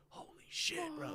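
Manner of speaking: high breathy gasp of awe; amazed. Retold or imitated. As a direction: blown away, voice jumps up. Amazed reaction in retold moment.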